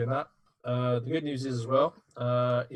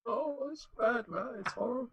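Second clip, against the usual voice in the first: high, slow, hammed-up wail of dismay; mock despair; 12 semitones higher, 5 dB quieter, slower than usual. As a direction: whine it like it's the end. Bad card drawn, mock despair.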